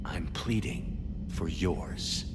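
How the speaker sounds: menacing whisper. Acted. Commander to his captured clone, whispered threat. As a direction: breathe the threat, barely voiced.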